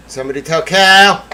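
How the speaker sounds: usual speaking voice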